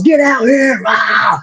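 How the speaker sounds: hammed-up victory shout; retold cage roar. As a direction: roar like after a quick win. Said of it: "I was losing it".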